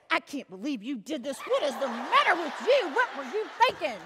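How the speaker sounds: comically swooping, exasperated mock scolding; mother's scolding. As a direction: imitate a fussing mama, pitch lurching up and down. Scolding mother acted while moving along.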